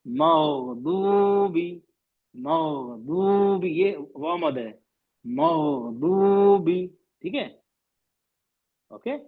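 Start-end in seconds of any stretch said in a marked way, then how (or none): 0.1-3.7 "مغضوبی" very slow, time given to the gh; lingering on ghayn. Shown as a demonstration. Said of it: "غین کو تھوڑا وقت دیجیے". 5.2-6.9 "مغضوبی" very slow, gh given time; lingering on ghayn. Shown as a demonstration. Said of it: "غین کو تھوڑا وقت دیجیے".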